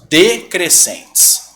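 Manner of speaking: decrescentes stretched out, syllable by syllable; drawn out slowly. Shown as a demonstration. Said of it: "O que que acontece nos ditongos decrescentes?". (devagar, sílaba por sílaba)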